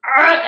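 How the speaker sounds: loud, high exasperated argh; frustrated growl. Retold or imitated. Coming home furious, a loud frustrated argh. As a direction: let out all the pent-up fury. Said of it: "I'm just like".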